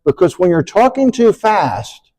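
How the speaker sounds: ordinary talk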